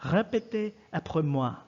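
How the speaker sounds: "Répétez après moi" with French vowels and a throaty R; teacher gives the French classroom command; French phrase. Shown as a demonstration. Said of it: "Repeat after me".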